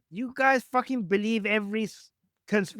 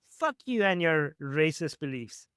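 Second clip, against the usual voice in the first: ordinary delivery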